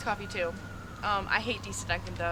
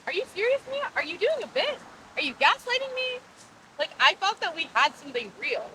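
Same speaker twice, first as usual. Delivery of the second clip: higher-pitched, incredulous questions; indignant; 6 semitones higher than usual. Acted. Nicole confronts Mia about doing a bit, indignant. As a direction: challenge her in rising disbelief. Lovers' quarrel over the accent.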